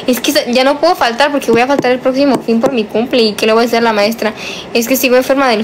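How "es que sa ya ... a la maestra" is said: ordinary delivery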